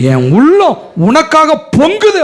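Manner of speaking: high, loud outburst of longing; impassioned high cry; fervent cry. Acted. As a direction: let the heart burst out on உள்ளம். God's heart overflowing.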